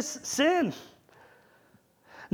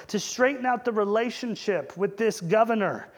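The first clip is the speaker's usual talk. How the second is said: ordinary talk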